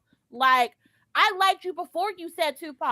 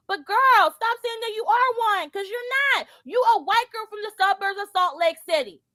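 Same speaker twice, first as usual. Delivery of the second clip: exasperated call-out; 8 semitones higher, 5 dB louder than usual. Real, not acted. Calling her out, raised voice.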